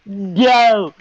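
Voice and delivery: loud, high-pitched drawn-out wail; hammed-up crying protest; mock bawling. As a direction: imitate a bawling tantrum, stretching the words. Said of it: "he starts bawling, fucking crying".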